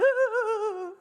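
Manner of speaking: high, comic wailing sob; hammed-up boo-hoo wail; imitated crying. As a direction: mimic someone sobbing on and on, high-pitched. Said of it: "she's just crying".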